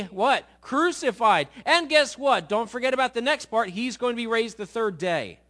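loud, urgent, driving each point home; emphatic announcement. Acted. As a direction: preach it like a warning to the guys. Jesus announces his coming death.

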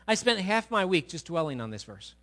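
ordinary talk

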